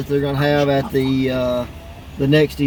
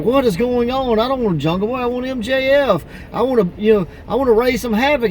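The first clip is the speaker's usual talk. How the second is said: higher, exasperated complaining voice; frustrated Hangman Page; 8 semitones higher than usual. Retold or imitated. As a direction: whine it up high, fed up with the mix-up. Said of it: "Page is like".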